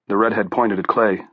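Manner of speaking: ordinary delivery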